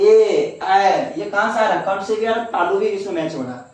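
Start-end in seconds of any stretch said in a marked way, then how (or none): 0.0-1.1 "ए" then "ऐ" called out loudly and held; vowel sound demo. Shown as a demonstration. Teacher asks pupils to say it with him, loud clear vowels. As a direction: belt out "ए" and "ऐ" so the class hears the difference. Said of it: "अब बोल के देखिए".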